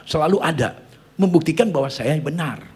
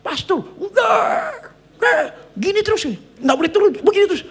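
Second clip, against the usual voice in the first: very high, loud strained yelling; hammed-up high-pitched urgent shout; imitated frantic shouting; 7 dB louder than usual. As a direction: yell out like a panicked captain. Acted panicked captain shouting at pastor.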